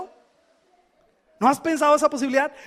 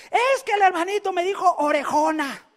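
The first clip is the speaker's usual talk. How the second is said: put-on higher, whining child voice; whiny little girl; 5 semitones higher than usual. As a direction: voice the hurt daughter complaining. Crying girl voiced in story.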